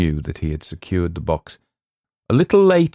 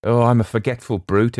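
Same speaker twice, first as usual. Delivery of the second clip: raised self-reproach; higher, lively outburst; exclaiming; 5 semitones higher, 4 dB louder than usual. A narrator's reading. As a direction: exclaim it, cross with yourself. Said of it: "exclaimed Will".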